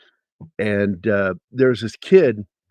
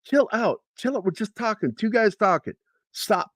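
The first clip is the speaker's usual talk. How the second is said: higher-pitched imitation of a sergeant calming a recruit; drill sergeant; 10 semitones higher than usual. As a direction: wave off the formality. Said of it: "He goes".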